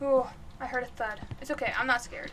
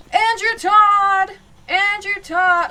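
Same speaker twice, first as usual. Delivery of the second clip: slow, drawn-out yell, louder and higher; yelling a name; 5 semitones higher, 10 dB louder, much slower than usual. Real, not acted. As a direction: call the ghost by name at full volume. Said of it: "by yelling the guy's name out".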